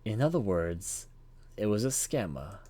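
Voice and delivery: usual speaking voice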